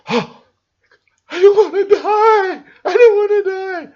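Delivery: high, loud hammed-up panic; mock terrified victim; terrified wail. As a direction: wail it like a hostage begging. Terrified customer voiced.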